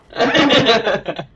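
loud, high burst of real laughter. Real, not acted. Player laughing at a game glitch, loud and high.

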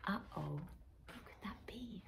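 fast secretive whisper of a hidden watcher; hushed whisper. Real, not acted. Narrator whispers to children about the watching eye. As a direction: whisper as if sharing a spooky secret. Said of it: "narrator whispers about the eye".

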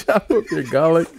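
high-pitched, cracking up; laughing. Real, not acted. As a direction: repeat the joke through real laughter. Cracking up at co-host's joke.

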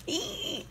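high delighted squeal; excited squeal. Real, not acted. Squeal over a gift.

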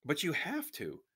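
high-pitched desperate plea; urgent pleading, pitch jumping up; begging. A narrator's reading. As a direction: beg them to change their minds. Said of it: "Samira begged".

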